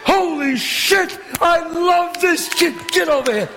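high, drawn-out whoop of joy; elated shouting. Acted. Gambler thrilled by the kid's winning roll, elated shouting.

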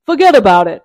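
loud mock New York Italian accent; Godfather Italian American. Hawker hamming up Italian American for tourists, loud. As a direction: bark it like a movie mobster. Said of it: "put on Godfather, American Italian".

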